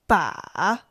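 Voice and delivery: Mandarin third tone Ba. Shown as a demonstration. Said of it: "Here are the four tones".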